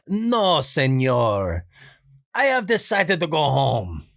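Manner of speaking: put-on a nice guy begging off in a thick Spanish accent; hint of dubbed Spanish accent; Benito voice. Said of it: "more senor for the accent there".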